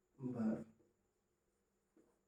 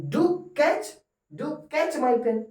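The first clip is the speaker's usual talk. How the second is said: "do" stressed much higher, slow; 9 semitones higher, much slower than usual. Shown as a demonstration. Teacher demonstrating emphatic command. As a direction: plead with stressed "do". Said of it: "जोर से बोलना".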